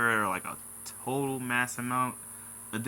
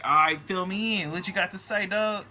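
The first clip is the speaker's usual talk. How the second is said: higher, louder goading reply; eager challenge; 8 semitones higher, 7 dB louder than usual. Retold or imitated. Answering the post's writer as a reader, eagerly prompting. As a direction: urge the writer on, loud and high. Acts out his reply to post.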